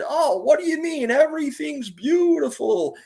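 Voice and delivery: high-pitched, drawn-out mock delight; imitated exuberant reassurance. Retelling a friend's answer to "not that good", exuberant.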